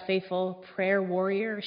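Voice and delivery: usual speaking voice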